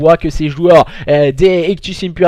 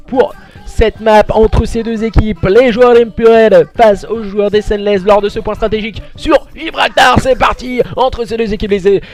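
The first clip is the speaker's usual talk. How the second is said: play-by-play commentary; 3 semitones higher than usual. Real, not acted. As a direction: call the match live like a caster. Live esports play-by-play.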